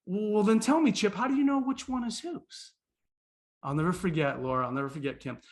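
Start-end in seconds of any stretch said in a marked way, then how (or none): 0.1-2.7 higher, climbing puzzled question; baffled questioning. Retold or imitated. Visitor asking Chip whose carton is whose, playfully baffled. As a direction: press the child with playful bewilderment.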